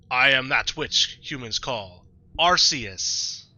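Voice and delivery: slow, stately deity proclamation; Arceus, godlike narrator. Acted. Player voicing Arceus introducing itself, grand and drawn-out. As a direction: draw it out with divine grandeur. Reads the game's Arceus dialogue aloud.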